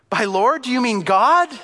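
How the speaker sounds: high, loud incredulous call upward; astonished question. Acted. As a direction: ask in amazement, pitch leaping up. Jack astonished at the voice.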